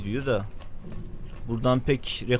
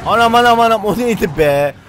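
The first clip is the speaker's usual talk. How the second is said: startled scream; 11 semitones higher, 12 dB louder than usual. Real, not acted. Startled by a monster jumpscare.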